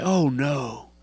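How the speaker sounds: low, soft, reverent praise; hushed prayer. Pretend. The right way at sunset, a soft praise to God. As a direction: speak it quietly to God, in awe.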